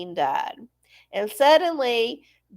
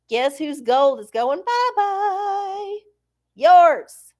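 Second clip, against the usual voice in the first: mocking taunt; 7 semitones higher, 4 dB louder, much slower than usual. Taunting viewers about seized gold.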